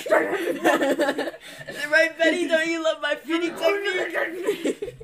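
high laughing talk to horse; laughing coo. Pretend. Cooing to horse.

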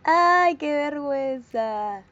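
loud "ay", then a slow, laughing "qué vergüenza"; laughing cringe. Real, not acted. (ay, con risa y vergüenza, lento)

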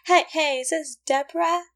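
high, put-on housewife phone chatter; hammed-up delight between two housewives; fifties housewife. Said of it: "stay-at-home moms, they're all like".

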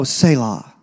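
mock cool. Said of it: "say something really cool and go".

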